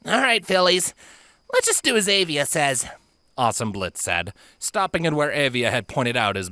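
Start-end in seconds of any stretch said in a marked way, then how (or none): 0.1-2.9 put-on high brave young girl; rally the group, trying to be brave; tomboy filly voice. Pegasus rallies the group.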